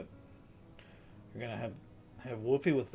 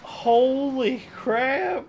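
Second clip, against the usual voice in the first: higher, louder, very slow exclamation; shocked shout; 10 semitones higher, 9 dB louder, much slower than usual. Real, not acted. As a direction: stretch it out in shock. Villain appears on screen.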